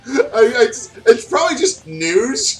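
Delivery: high, giggly, embarrassed words; embarrassed laughing. Real, not acted. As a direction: laugh through the awkward word. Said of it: "I feel weird saying Knooch".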